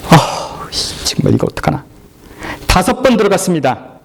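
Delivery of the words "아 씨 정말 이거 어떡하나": breathy exasperated muttering; frustrated mutter. Acted. Naaman mutters in frustration after the fourth dip. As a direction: mutter a curse under the breath. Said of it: "더 화가 났습니다".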